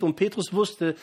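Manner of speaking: fervent preaching. Acted. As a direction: preach it out to the whole church.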